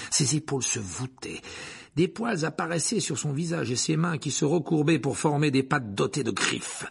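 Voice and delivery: usual speaking voice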